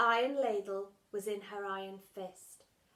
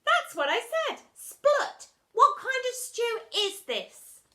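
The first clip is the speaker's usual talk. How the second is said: put-on 1 girl voice; 12 dB louder, slower than usual.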